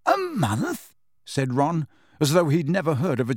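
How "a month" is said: high-pitched astonished question; astounded echo, far higher in pitch; incredulous. A narrator's reading. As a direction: swoop up in disbelief. Ron incredulous at the journey's length.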